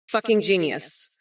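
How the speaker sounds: normal talking voice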